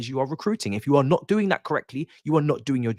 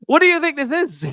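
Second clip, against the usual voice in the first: high-pitched, louder disbelief; incredulous outburst; 6 dB louder than usual. Real, not acted. Streamer laughing off a viewer's bet suggestion, incredulous.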